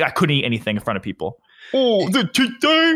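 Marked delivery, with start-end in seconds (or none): 1.7-3.0 much higher, slow drawn-out teasing; mocking friends. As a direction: stretch it out like an annoying friend. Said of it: "Everyone made that joke".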